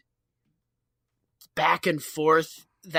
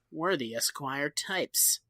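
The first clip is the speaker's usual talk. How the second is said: 1 smug low line; 5 semitones lower, 9 dB quieter, slower than usual. Retold or imitated. Barney smug line in jail.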